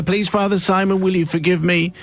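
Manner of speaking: ordinary talk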